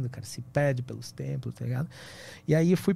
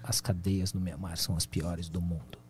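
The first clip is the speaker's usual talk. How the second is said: death voice; 7 semitones lower, 5 dB quieter than usual. Retold or imitated. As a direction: drag it out like a slowed record. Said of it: "o cara tinha uma voz de morte, disco em baixa rotação".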